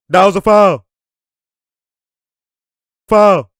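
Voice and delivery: loud indignant call; yelling foul at the replay. Real, not acted. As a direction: shout the foul at the screen. Calling the foul at the screen.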